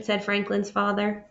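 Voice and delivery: plain reading voice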